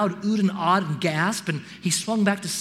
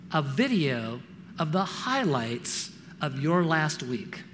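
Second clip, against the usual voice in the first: usual speaking voice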